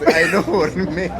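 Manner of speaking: ordinary talk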